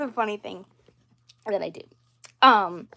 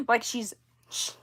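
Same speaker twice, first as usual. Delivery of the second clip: ordinary talk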